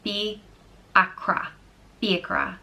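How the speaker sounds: "Fiachra" drawn out slowly, guttural ch; slow model word. Shown as a demonstration. Modelling the name, slow and careful. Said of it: "It's pronounced".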